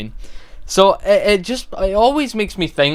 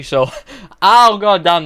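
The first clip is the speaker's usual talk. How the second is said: laugh, then gasp at the sore tongue; painful laugh; 5 semitones higher, 6 dB louder, slower than usual. Real, not acted. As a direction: laugh that turns into a wince of pain. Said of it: "that hurt when I laughed".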